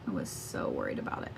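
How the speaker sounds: hushed worried whisper. Real, not acted. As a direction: whisper it, voice dropping away. Repeats line as whisper.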